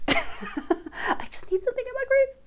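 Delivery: silly high voice. Pretend. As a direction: say it in a high cartoonish voice. Playful high mock voice.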